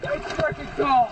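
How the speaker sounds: high, gasping complaint about the cold; shocked by cold. Real, not acted. Just surfaced in cold water.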